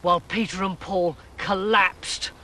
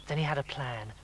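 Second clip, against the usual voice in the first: plain reading voice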